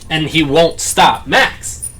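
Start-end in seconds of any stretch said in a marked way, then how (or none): 1.2-1.9 much higher sharp call; exasperated owner scolding; calling the dog. Real, not acted.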